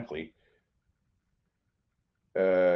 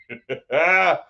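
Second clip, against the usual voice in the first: a real, choked-up emotional laugh; teary laugh; 3 semitones higher, 4 dB louder than usual. Real, not acted. Vlogger moved by his favourite cruise channel, laughing through tears. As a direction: laugh breaking toward tears. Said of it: "You're gonna make me cry".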